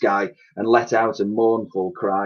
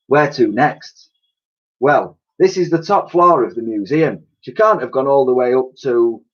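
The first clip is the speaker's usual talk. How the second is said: character voices; 4 semitones higher, 5 dB louder than usual. A narrator's reading.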